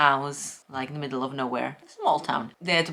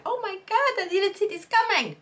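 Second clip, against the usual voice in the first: much higher-pitched gushing shock; mock astonishment. Reader imagining a plot twist that landed, mock astonishment. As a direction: act out a shocked reader's high squeal. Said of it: "then I would be like".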